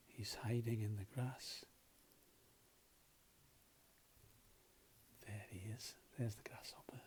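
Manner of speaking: hushed secret whisper; breathy storytime whisper. Acted. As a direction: whisper so the grasshopper won't hear. Hiding grasshopper, whispering to child.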